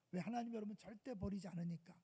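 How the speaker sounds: ordinary talk, nothing marked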